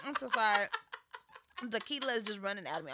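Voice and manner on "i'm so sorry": usual speaking voice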